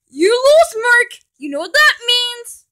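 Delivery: high, loud taunting; gloating shout. Acted. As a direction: rub the win in. Gloating at loser.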